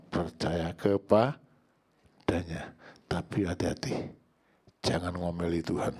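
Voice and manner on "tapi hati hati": ordinary delivery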